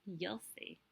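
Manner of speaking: unvoiced teasing whisper; secretive whisper. A narrator's reading. Eggshell teasing her neighbor, whispered. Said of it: "she said".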